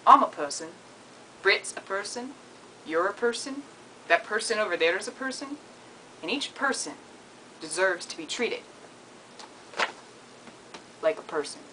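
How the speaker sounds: soft, measured attempt at a New Zealand accent; lightly put-on New Zealand accent. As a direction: deliver a little New Zealand speech, quietly. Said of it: "say that in a New Zealand accent".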